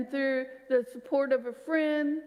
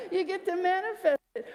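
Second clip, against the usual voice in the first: laughing; 7 semitones higher than usual. Real, not acted. Joke about perfectionists.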